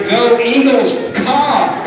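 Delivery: high, loud, drawn-out cheerleader yell; stretched-out stadium cheer with a caw; cheer shout. Shown as a demonstration. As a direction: belt it like a pep rally cheer. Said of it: "the cheer".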